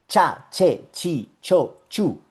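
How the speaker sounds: "Cha, che, chi, cho, chu" with crisp tch; ch syllables. Shown as a demonstration. Teacher drilling ch syllables.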